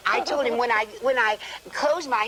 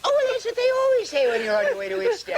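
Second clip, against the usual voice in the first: laughing; 12 semitones higher, faster than usual. Retold or imitated. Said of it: "Lady Astor said".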